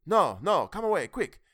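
high, loud panicky urging; frightened urging. A narrator's reading. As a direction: hurry them away in fear. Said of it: "The reply rang out quickly".

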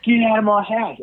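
high-pitched imitation of her yelling; mock angry yell. Retold yelling during eviction.

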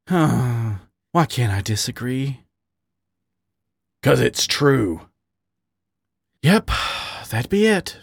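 slow, resigned sigh of an answer. A narrator's reading. Man admitting to his partner why he can't disagree, sighing. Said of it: "Wally sighed".

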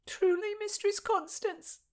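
high, hopeful maid's voice; hopeful disbelief. Acted. Maid asks her mistress if she may marry, hopeful high voice. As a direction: ask in hopeful wonder.

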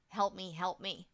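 ordinary talk

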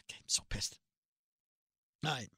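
unvoiced, annoyed whisper. Real, not acted. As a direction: hiss it under your breath, really fed up. Annoyed at late show time.

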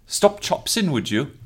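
high, bouncy Welsh accent; a mild Welsh accent. Shown as a demonstration. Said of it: "you're funny with accents".

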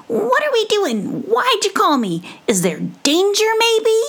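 very high, eager squeaky mouse; hopeful little mouse. Acted. Mouse asking his friend why she called, eager squeak. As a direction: squeak it out, hoping for danger. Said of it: "he sounded hopeful".

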